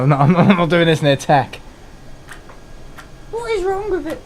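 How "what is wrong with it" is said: exasperated high whine. Real, not acted. Frustrated with matches.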